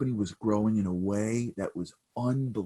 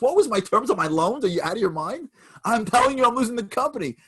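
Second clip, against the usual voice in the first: much higher and louder, frantic; desperate outburst; 14 semitones higher, 7 dB louder than usual. Retold or imitated. Bankrupt CEO yelling at the stranger, frantic and desperate. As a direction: plead in frantic anger. Son desperate, losing company.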